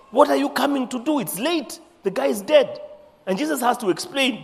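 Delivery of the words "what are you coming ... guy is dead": exasperated reproach. Acted. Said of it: "You know".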